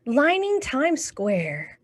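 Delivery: put-on picture book read aloud to kids; lively storytime reading; story reading. Teacher reading a New Year book to children, storytime voice.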